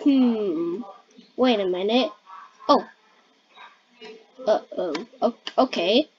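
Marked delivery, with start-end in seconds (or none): none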